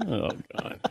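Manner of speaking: ordinary talk, nothing marked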